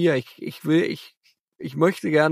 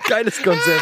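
laughing; 3 semitones higher than usual. Real, not acted. Still laughing at the joke.

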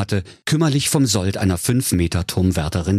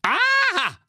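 much higher mocking witch's aha; scornful. The witch catching Enrico, scornful high aha. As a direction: sneer it as the witch. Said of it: "rief sie höhnisch".

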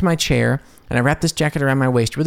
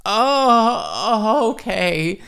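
a high, startled oh dropping to okay; startled reply to the set manager, high then falling; 9 semitones higher, much slower than usual. Retold or imitated. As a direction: caught off guard, voice jumping up. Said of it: "And it's like".

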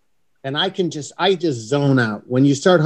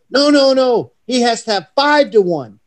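excited bargaining; 9 semitones higher, 4 dB louder than usual. Retold or imitated. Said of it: "these two guys go".

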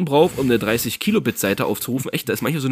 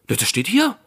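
clerk's voice; 6 semitones higher than usual. Retold or imitated.

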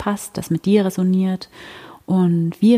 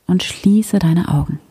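sleep story narrator. Acted. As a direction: ease the listener gently into stillness. Leads a silent heart meditation.

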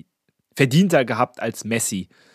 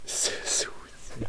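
whispering; 11 dB quieter, much slower than usual. Real, not acted. Incredulous echo of the mispronunciation.

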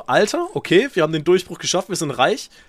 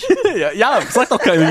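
laughing while talking; 8 semitones higher than usual. Real, not acted. Laughing reply.

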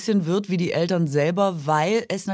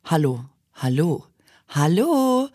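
hello variations; slower than usual. Shown as a demonstration. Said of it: "ganz viele verschiedene Hallo-Varianten".

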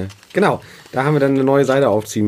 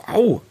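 kurzer hoher Aufschrei vor Schmerz; plötzlicher Schmerzlaut; Schmerzensschrei; 11 semitones higher than usual. Real, not acted. Saite in den Fuß gerammt.

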